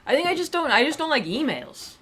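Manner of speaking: insist, pitch shooting up; defensive high protest. Real, not acted. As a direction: voice jumps up defensively.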